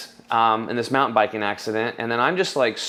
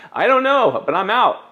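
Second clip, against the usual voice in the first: defiant; 9 semitones higher, 5 dB louder than usual. Retold or imitated. Quitting job, retold defiant reply.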